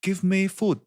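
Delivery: high British prank voice; clean British accent. Retold or imitated. Prank customer at drive-through, high British accent. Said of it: "really clean British accent".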